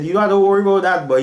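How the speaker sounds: high, quick and animated reassurance; reassuring mentor. Retold or imitated.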